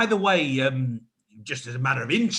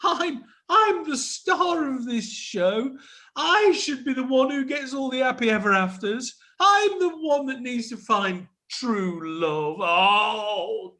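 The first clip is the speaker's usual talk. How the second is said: high whiny fake sobbing; hammed-up tantrum, near tears; mock crying; 9 semitones higher than usual. Star upset at losing groom.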